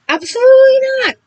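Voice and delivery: high, loud cry of refusal; frantic no-way yell; panicked refusal. Acted. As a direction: shout it down in terror, fast.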